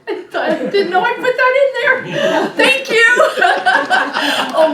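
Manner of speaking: high, loud, laughing embarrassed outburst; real laughter. Real, not acted. Presenter caught out by her own slide, laughing. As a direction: crack up laughing while speaking.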